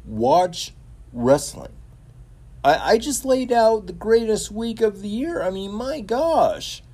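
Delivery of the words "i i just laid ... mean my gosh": excited gushing. Real, not acted. Raving about a wrestling week.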